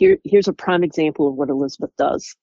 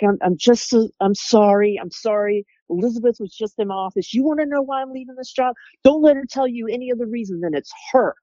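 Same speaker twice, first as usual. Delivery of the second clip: upset coworker; 7 semitones higher than usual. Retold or imitated. Upset coworker quoted venting about Elizabeth.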